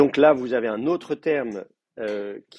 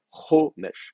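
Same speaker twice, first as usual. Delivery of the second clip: Chomesh with a long, stressed CHO and a light -mesh; stress on first syllable; 5 semitones higher, 4 dB louder than usual. Shown as a demonstration. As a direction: lean on the first syllable of Chomesh, let the second drop. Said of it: "l'accent est sur la première syllabe".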